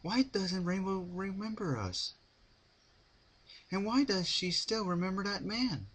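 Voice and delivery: put-on high, bright-eyed pony girl voice; bouncy Pinkie. A narrator's reading. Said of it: "Bounced Pinkie".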